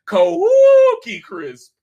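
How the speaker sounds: high, loud, drawn-out mock howl; hammed-up howling cry; wolf howl. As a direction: howl it at the moon, long and loud. Howling like wolves.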